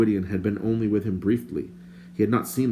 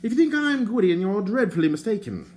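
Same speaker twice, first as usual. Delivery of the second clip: higher, prim and indignant stranger; lightly voiced fussy stranger; 11 semitones higher, 4 dB louder than usual. A narrator's reading. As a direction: play the fussy bard, put out.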